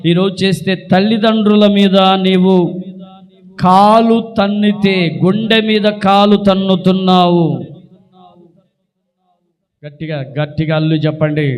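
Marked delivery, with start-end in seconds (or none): 0.1-7.5 loud, slow, weighty reproach; slow down and drive every word home; forceful rebuke. Real, not acted. Preacher rebukes children who kick their parents, loud and reproachful.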